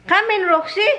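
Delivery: high coaxing call; calling the dog. Retold or imitated. Coaxing Roxy inside, calling high. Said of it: "And then I said".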